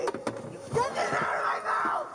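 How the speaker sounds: desperate yell for release; shouting. Real, not acted. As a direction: yell it, pleading and angry. Arrestee yelling in custody.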